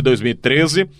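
ordinary talk, nothing marked

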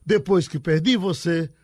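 reciting a love verse with full feeling, settling at the end; poem recital. Acted. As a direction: declaim it like a repentista's verse. Reads the repente verse aloud.

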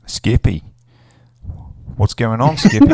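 loud, high mock Australian accent, Skippy shot way up; hammed-up Australian, yelling the name Skippy. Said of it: "We'll come back to the accents".

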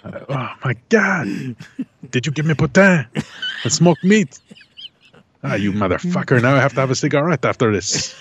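put-on high-pitched, excited French-Canadian accent. Wife thrilled by poutine, hammed-up French-Canadian accent. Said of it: "Put on the accent".

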